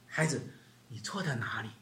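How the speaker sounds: put-on soft, quiet questioning of a child; a parent softly asks a child what they did wrong; gentle asking. Shown as a demonstration. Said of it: "你必须要告诉这个孩子说".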